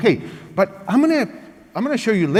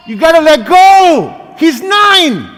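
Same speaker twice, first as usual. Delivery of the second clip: louder, higher exasperated outburst; firm parent snapping; 8 semitones higher, 8 dB louder, slower than usual. Acted. Firm parent scolds the kind spouse about the child, louder and higher. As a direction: push back hard at the coddling spouse.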